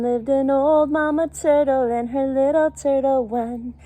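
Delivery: lively sing-song read-aloud with little voices for animals; storybook reading. Acted. Reading a picture book to young children, sing-song storytelling.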